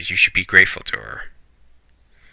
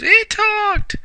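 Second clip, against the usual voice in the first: mock freak-out, shrieked very high; 10 semitones higher than usual. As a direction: squeal it way up in startled panic. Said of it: "Usagi freaks out and goes".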